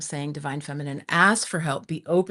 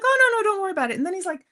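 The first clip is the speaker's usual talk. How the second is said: much higher, breezy mock reassurance; cheerful helper; 12 semitones higher, 3 dB louder, much faster than usual. Stranger brushing off her worry, higher breezy imitation. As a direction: squeak it out like an eager helper.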